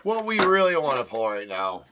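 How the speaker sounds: loud hyped call; loud excited breaker; excited shout. Real, not acted.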